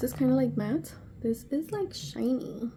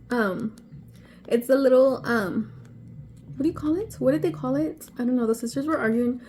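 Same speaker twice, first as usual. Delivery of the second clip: ordinary talk, nothing marked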